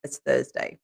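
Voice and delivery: low, soft deadpan reveal. Retold or imitated. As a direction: drop it flat and knowing. Deadpan punchline to son.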